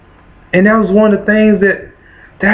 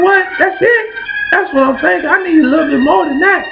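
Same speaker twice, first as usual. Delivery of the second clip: high, pleading cry for more help; desperate plea; 9 semitones higher than usual. Retold or imitated. Son begging for more than "hang in there", high pleading. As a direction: beg for a real word, voice climbing high. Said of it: "I'm like".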